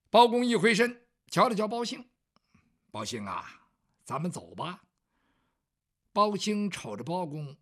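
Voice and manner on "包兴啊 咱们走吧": much lower, soft composed master; calm young lord. Acted. Bao Gong telling Bao Xing to leave, low and soft. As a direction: gently tell your servant it's time to go.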